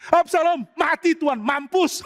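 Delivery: high, eager, gloating report; gleeful blurt. Acted. Second runner gleefully tells King David of Absalom's death.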